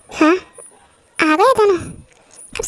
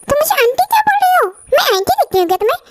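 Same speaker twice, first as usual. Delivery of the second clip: mock offended mom; 8 semitones higher, faster than usual. Mother offended at being called aunty.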